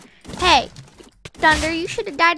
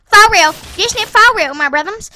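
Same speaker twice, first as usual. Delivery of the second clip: hyped insistence; 3 semitones higher, 9 dB louder than usual. Real, not acted. Excited about getting sniper.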